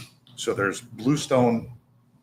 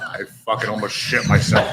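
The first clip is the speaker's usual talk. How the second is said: normal talking voice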